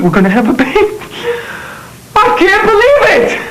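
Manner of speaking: drawn-out, high cry of elated disbelief; overjoyed. Acted. Man hearing he will be a father, overjoyed. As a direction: voice leaping high with joy.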